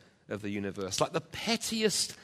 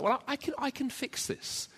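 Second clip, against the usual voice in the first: much higher, airy mock confidence; mock the proud self-reliant voice; self-confident voice; 12 semitones higher than usual. Preacher mocking the proud self-reliant voice. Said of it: "tempted to think things like".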